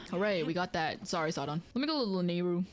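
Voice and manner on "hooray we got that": usual speaking voice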